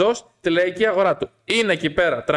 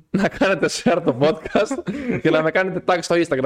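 talking through real laughter; laughing appeal; 2 semitones higher than usual. Real, not acted. Host asking listeners to share the podcast, laughing. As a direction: giggle through the plug.

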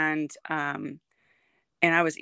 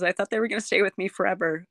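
ordinary talk

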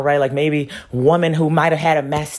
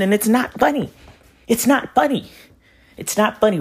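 angry insistence; 7 semitones higher than usual. Real, not acted. Repeating in anger.

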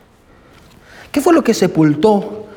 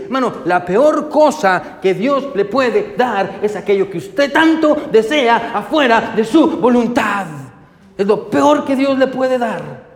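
slow, higher, emphatic proclamation; preaching at full force; 4 semitones higher, slower than usual. Acted. Preacher proclaims the sermon's key line to the brothers, emphatic. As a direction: hammer each word home slowly.

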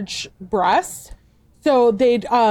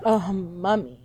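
playful mock moan; 3 semitones lower, 6 dB quieter, slower than usual. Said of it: "playfully moan out and be like".

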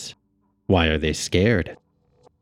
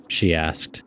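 plain reading voice

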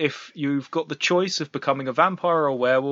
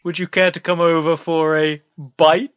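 mock vampire host; 3 semitones higher, 4 dB louder than usual. Plays vampire's guest, punning on bite.

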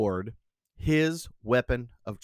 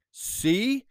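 high, theatrical call to the sea; king crying out; 9 semitones higher than usual. A narrator's reading. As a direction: hail the waves like a king. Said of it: "cried Canute".

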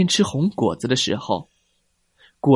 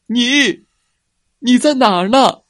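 much higher, louder search call; anxious calling out; 11 semitones higher, 6 dB louder than usual. Acted. The T-rex dad calling for missing Ni, anxiously loud. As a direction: call across the hillside for the lost one.

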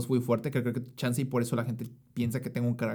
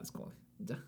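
whispered aside; 18 dB quieter than usual. Real, not acted.

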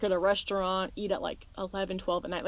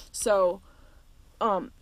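coughing, then a slow louder sorry; choking cough; 4 dB louder, slower than usual. Real, not acted. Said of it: "choking on everything".

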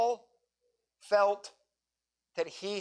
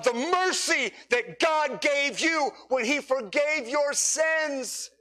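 high, ringing preacher's call; impassioned sermon climax; fervent preaching; 7 semitones higher, slower than usual. Acted. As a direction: plead with the whole room to give their lives. Preaching at full force.

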